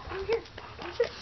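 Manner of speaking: coaxing call. Real, not acted. Calling the dog over.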